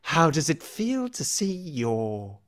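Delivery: high, loud rasping creature voice; gloating rasp, unsettling. Acted. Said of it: "a rasping voice".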